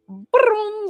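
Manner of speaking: high, loud imitated whoosh of a running dog; high "broom" zooming noise. Pet psychic imitating the dog bolting off, high zooming noise. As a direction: zoom off with a high-pitched "broom". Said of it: "she's gonna be like".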